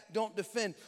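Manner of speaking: ordinary talk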